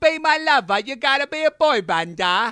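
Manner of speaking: high, loud mock British pop singer; hammy British accent, singing it out. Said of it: "You can't just shake a head and be British".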